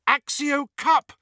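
high, drawn-out cry; desperate cry. A narrator's reading. Hermione trying to summon the cup, high desperate cry. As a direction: cry out the spell, pitched right up. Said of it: "cried Hermione".

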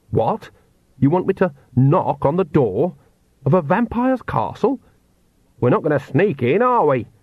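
lightly voiced nervous man balking at an order; alarmed Oates. A narrator's reading. Oates's voice in story.

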